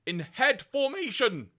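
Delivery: words break into a crack. Real, not acted. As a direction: voice cracks up high, slowing. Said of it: "My voice cracked".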